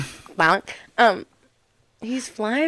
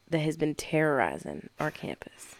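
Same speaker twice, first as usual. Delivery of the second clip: whispering; 4 semitones lower, 7 dB quieter than usual. Real, not acted. Spooky story whisper.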